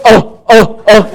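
high mock seal barks; seal barking. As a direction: bark repeatedly like a SeaWorld seal. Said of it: "Remember, we went to SeaWorld?".

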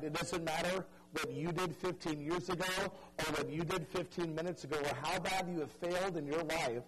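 ordinary talk, nothing marked

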